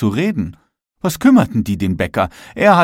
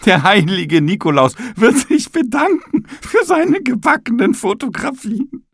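very high, hesitant, fussy ceiling voice; the ceiling squeaking out its excuses, high and louder; prissy high ceiling explaining proper Nikolause; 9 semitones higher, 4 dB louder than usual. A narrator's reading. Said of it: "entschuldigte sich die Decke".

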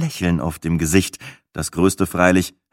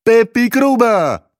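high, loud, sing-song warning; admonishing rhymester; 12 semitones higher, 5 dB louder than usual. A narrator's reading. Gruber claims rhyming as his alone, admonishing in verse. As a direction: proclaim it as a rhymed rebuke, ending stretched. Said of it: "Mahnend wackelte Gruber mit seinem Zeigefinger".

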